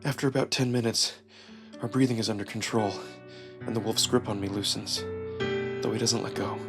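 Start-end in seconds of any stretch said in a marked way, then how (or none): none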